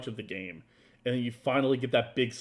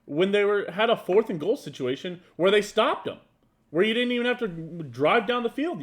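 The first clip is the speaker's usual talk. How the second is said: raised, higher, insistent protest; arguing loudly and higher; heated; 9 semitones higher, 6 dB louder than usual. Real, not acted. Heated football argument, raised voice.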